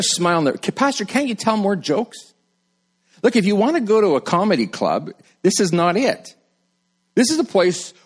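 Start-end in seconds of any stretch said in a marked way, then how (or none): 0.5-2.3 whiny congregant. Retold or imitated. Congregant pleading for jokes.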